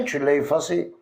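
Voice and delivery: ordinary talk, nothing marked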